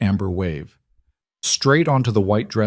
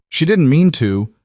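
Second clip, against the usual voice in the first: mimicking whine, higher and louder; high-pitched mocking; 7 semitones higher, 5 dB louder than usual. A guest mocks Elena's plea in a high taunting voice. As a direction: parrot her back in a sneering high voice. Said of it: "someone mocked in a high-pitched voice".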